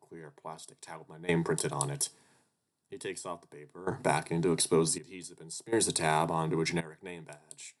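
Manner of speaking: ordinary talk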